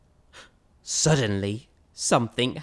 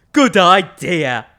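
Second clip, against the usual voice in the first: louder, slow, emphatic approval; big skeleton agreeing; 2 semitones higher, 10 dB louder, slower than usual. Acted. Big skeleton agrees with the little skeleton, loud and slow. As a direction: draw out the approval heartily.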